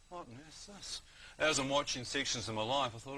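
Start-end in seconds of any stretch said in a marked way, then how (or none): 0.1-1.0 soft, higher bewildered murmur; hushed bewilderment. Retold or imitated. Man recalls his life flashing before him, hushed bewilderment. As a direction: puzzle over it under your breath. Retold baffled thought while dying.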